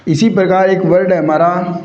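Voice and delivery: normal talking voice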